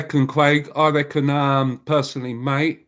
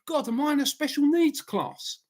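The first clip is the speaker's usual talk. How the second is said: lightly voiced, pitched up mock thought; imagined thought; 10 semitones higher, 3 dB quieter than usual. Imagining Trump's guest in a mild mocking voice. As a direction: play him bewildered, mocking. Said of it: "you must be thinking".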